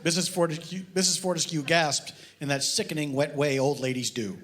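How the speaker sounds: normal talking voice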